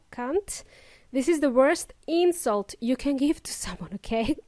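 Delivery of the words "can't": CAN'T with a short ah, rising at the end; short-vowelled CAN'T. Shown as a demonstration. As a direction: say CAN'T briefly, as the mistake. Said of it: "Don't say short ah".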